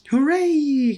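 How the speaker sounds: high, loud cheer. A narrator's reading. Children cheering at the teacher's news, high and loud. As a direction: whoop it up like a class of kids. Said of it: "cried the children".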